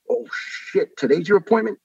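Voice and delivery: high-pitched imitation of startled realization; retold "uh-oh", jumping high; caught-out surprise. Driver learns of patient's missed appointment, startled.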